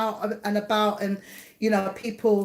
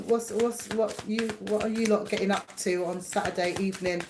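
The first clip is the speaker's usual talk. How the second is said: ordinary delivery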